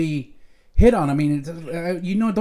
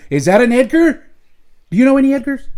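higher and louder, eagerly asking; eager question; 7 semitones higher, 7 dB louder than usual. Retold or imitated. Father quizzing his daughters about Edgars, excited and loud. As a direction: pester the girls like an excited dad. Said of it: "I'll ask them".

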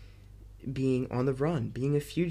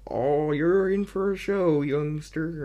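higher, louder hammed-up cop voice; older cop; 3 semitones higher, 5 dB louder, slower than usual. Reviewer imitating the older cop, hammed-up. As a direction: ham it up like a gruff veteran cop. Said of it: "the older cop, is like".

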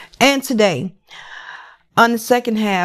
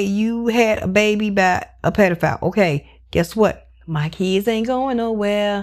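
normal talking voice

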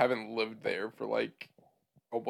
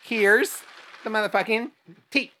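excited announcing; 11 semitones higher, 10 dB louder than usual. Real, not acted. Announcing the gossip.

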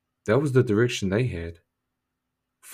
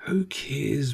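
breathy whispered dismissal; 5 semitones higher than usual. Acted. Brushing off the critics, whispered. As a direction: whisper it like a secret.

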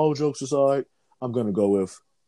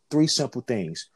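ordinary talk

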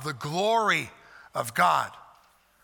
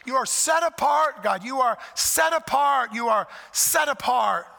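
preaching emphasis; 6 semitones higher, 4 dB louder than usual. Acted. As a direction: lean hard into each so far. Emphatic repetition.

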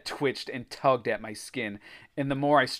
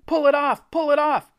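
loud, very high pleading yell; panicked shriek; 8 dB louder than usual. A narrator's reading. Boy begging friends to remove the blob, shrieking. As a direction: scream for help in panic. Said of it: "I shrieked".

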